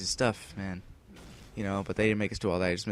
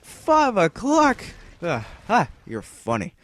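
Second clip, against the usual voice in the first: high, loud, slow shocked squeal; disbelief; 9 semitones higher, 8 dB louder, slower than usual. Retold or imitated. Teen hearing he is stuck at school, loud shocked squeal. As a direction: react in outraged disbelief. Shocked at five o'clock news.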